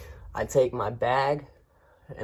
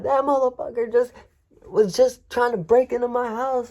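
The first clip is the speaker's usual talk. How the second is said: much higher mock furious shout; imitating angry accusation; 12 semitones higher, 5 dB louder than usual. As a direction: rant it like an outraged homeowner. Homeowner angrily accusing.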